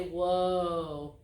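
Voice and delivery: deep, level "Whoa."; low calm whoa. Shown as a demonstration. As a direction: say "Whoa." low to stop the horse. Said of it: "you say".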